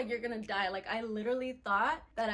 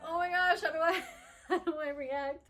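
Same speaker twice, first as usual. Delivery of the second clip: panicked laugh; 6 semitones higher, 5 dB louder than usual. Retold or imitated. Panicked thought at the open sky.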